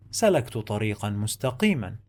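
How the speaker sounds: the whole sentence said fast in one go; now say it at full speed; fast run-through. Shown as a demonstration. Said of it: "I'm gonna read and write".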